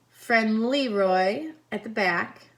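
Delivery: plain reading voice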